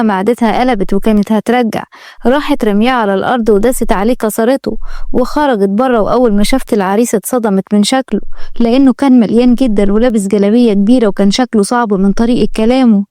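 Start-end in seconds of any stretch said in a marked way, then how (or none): none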